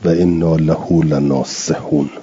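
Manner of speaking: measured chanted recitation of the verse; Quran recitation. Shown as a demonstration. Teacher reciting the verse to his students, measured chant. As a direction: recite it in tajwid style.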